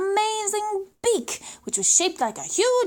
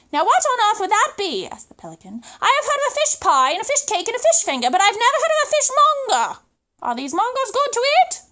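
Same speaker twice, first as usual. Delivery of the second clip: higher, louder, fast eager pelican voice; excited pelican; 6 semitones higher, 4 dB louder, faster than usual. Acted. Pelican asks the boy eagerly what a fishmonger is. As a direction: rattle off the fish foods, eager and baffled.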